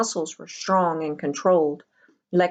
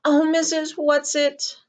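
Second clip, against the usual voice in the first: high, loud, slow cry for help; despairing call; 10 semitones higher, 4 dB louder, slower than usual. A narrator's reading. Meg held by the men, calling despairingly for Missus Whatsit. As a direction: call out desperately into the void. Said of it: "Meg called despairingly".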